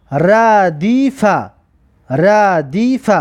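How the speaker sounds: very slow, drawn-out syllables; slow teacher's model reading. Shown as a demonstration. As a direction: stretch each syllable for learners to copy. Teacher models the blended word.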